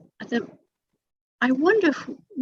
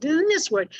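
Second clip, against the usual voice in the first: usual speaking voice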